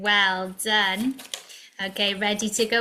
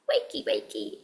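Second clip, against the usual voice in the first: whispered wakey wakey; 2 semitones higher, 8 dB quieter, faster than usual. Pretend. Waking puppet Red gently.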